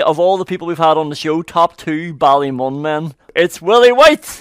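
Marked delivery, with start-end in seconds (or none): 3.4-4.4 grand guest intro. Acted.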